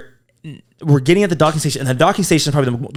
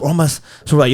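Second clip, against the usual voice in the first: ordinary talk, nothing marked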